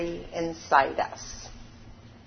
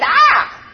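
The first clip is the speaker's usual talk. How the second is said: loud high cry thrown back; be the mountain echo; echoed scream; 13 semitones higher, 9 dB louder than usual. A narrator's reading. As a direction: echo repeating the cry loudly. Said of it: "a voice coming from the mountains that repeated".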